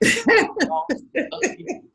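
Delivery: laughing through the words; slow, amused repeat with a laugh; chuckling at the memory. Real, not acted. Laughing at getting lost again.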